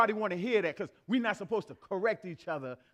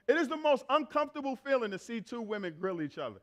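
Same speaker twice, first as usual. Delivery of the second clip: normal talking voice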